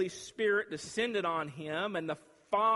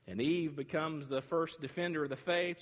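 normal talking voice

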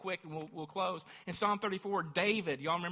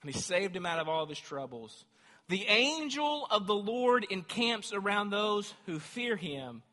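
full-force preaching; 5 semitones higher, 8 dB louder than usual. Acted. Preacher reading Psalm thirty-four to church, loud fervour. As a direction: proclaim it to the rafters.

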